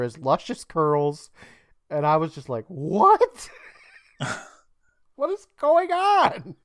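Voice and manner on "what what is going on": high, laughing disbelief; incredulous. Retold or imitated. Viewer reacts to Huey in a bathtub, incredulous and laughing. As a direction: squeal it in baffled amusement.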